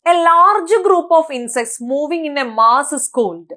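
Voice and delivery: reads the question out slowly and evenly; slow question reading. Shown as a demonstration. Teacher reading an exam question aloud, slow and clear. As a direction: dictate it slowly to the class.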